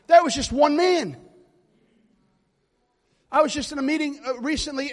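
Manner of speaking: very loud revival preaching; preaching at full force. Acted. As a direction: whip the congregation up, hollering.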